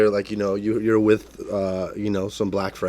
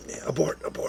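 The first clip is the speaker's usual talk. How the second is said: hushed, higher whisper; higher, whispered mock alarm; whispered abort; 6 semitones higher, 9 dB quieter than usual. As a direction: whisper it like a mission going wrong. Joking urgent retreat.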